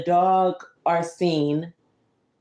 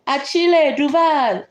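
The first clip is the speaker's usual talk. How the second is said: named in a high, slow announcing voice; a hint of a pompous policeman; lightly voiced proud introduction; policeman's name; 8 semitones higher, 5 dB louder than usual.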